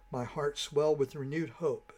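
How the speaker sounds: ordinary delivery, nothing marked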